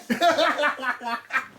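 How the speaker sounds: high laugh after a joke; real amused laughter. Real, not acted. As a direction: chuckle at your own outburst. Laughs after own fan cry.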